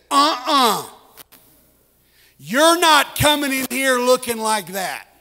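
put-on a mother barring the muddy boy at the door; stern mom. Mom blocking muddy son.

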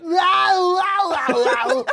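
high, loud mock wows; hammed-up heckling woman; mock woman heckler.

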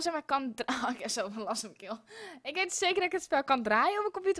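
ordinary talk, nothing marked

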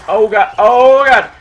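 loud, frantic panicked yell; panicked scream. Real, not acted. Panicked as an enemy attacks.

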